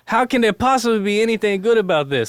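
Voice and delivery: high, loud Cajun joke delivery; Cajun accent. Shown as a demonstration. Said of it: "do my best Cajun accent".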